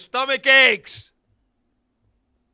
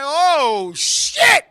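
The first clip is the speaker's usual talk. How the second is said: rising high and loud on shit; sudden alarmed outburst; mock shocked; 5 semitones higher, 8 dB louder than usual. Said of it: "I'm like".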